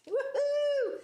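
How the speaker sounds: high, loud "woohoo" cheer; long, high-pitched cheer; celebratory whoop. Real, not acted. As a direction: whoop it up for a right answer. Host cheers a correct answer.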